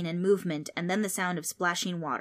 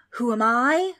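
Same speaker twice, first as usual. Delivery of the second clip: loud, higher indignant roar; 5 semitones higher, 9 dB louder than usual. A narrator's reading. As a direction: repeat it as an outraged ghostly roar. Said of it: "roared the ghost".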